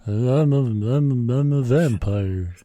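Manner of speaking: a hint of a bad Dracula; lightly voiced hammy vampire. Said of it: "That's a horrible vampire voice".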